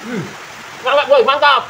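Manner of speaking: chewing while talking; speaking through a mouthful; mouth full of food. Real, not acted. Seen 0: Bite at -2.0; cheeks bulge, chewing while starting to speak.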